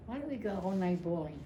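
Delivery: normal talking voice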